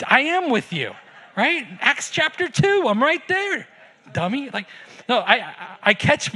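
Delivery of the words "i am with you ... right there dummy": high-pitched mock scolding from God; playfully chiding, pitch jumping way up; God's exasperated reply. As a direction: answer in a high, comic voice as a fed-up God. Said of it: "I feel like he just wants, like".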